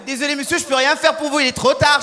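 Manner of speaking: lightly voiced hostess refusing a passenger; French airline hostess. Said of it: "l'hôtesse française qui est là".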